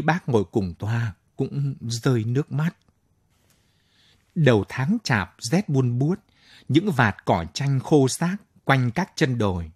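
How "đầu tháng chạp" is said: ordinary delivery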